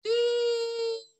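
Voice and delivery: long, high, unbroken monitor tone; imitated heart monitor flatline; flatline beep. Shown as a demonstration. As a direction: hold one piercing steady beep like a dead heart monitor.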